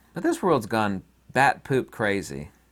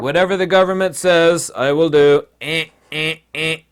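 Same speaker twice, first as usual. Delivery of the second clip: louder, higher obedient drone; mock brainwashed follower; 4 semitones higher, 7 dB louder than usual. Mocking obedient citizens who follow the government, brainwashed drone. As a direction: drone it like a brainwashed drone, ending in robotic grunts. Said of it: "totally brainwashed".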